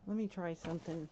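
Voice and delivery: normal talking voice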